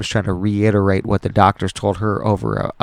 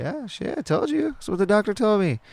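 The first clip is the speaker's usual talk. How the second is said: mock told you so; 13 semitones higher, 4 dB quieter than usual. Telling injured friend off.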